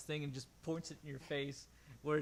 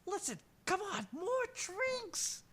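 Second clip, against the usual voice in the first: much higher, slurred pleading; drunk voice; 3 dB louder, slower than usual. Acted. Skag begs Rev for more drinks, drunk and high.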